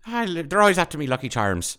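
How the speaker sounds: high, loud mock Irish leprechaun accent; hammed-up Irish cereal-ad leprechaun; Lucky Charms leprechaun. Playing the cereal-ad leprechaun to mock the bartender, Irish accent. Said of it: "normally he would sound like that".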